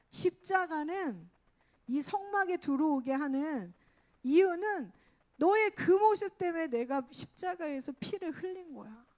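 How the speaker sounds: loud, high, urgent preaching as God; impassioned God. Acted. God explaining the cross to the children, impassioned plea. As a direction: plead forcefully in God's voice. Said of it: "너무 하나님이 고통스러운 것들이".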